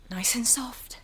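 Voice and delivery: hushed and soothing; soft whisper. Acted. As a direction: whisper it softly to the baby. Soothing baby.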